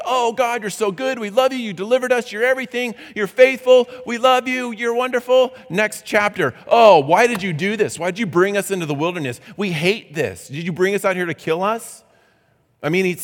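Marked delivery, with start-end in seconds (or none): 0.0-5.5 high, loud, gushing worship voice; mock gushing praise. Preacher mimicking Israelites praising God, gushing. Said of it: "worship and praise". 6.7-12.0 whiny complaining, fast and louder; mock grumbling. Preacher imitating Israelites grumbling at God, mock whine.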